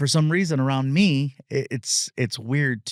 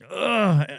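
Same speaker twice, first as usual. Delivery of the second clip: high groan of disgust; disgusted ugh; 7 semitones higher than usual. Retold or imitated. Comedian reacting to a clumsy fan, groaning "ugh". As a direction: let out a pitched-up "ugh" of disgust. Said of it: "I'm like".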